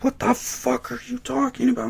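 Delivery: high, squeaky incredulous retort; mock disbelief. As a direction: exclaim it in a mock falsetto. Incredulous retold reply, acted.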